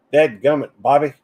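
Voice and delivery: Hank Hill. Shown as a demonstration. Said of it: "I'll try one or two things".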